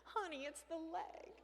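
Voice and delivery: hushed worried aside to husband; hushed alarm. Retold or imitated. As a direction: lean in and tell him quietly. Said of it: "I said".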